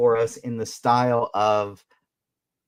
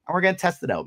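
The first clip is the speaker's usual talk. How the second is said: normal talking voice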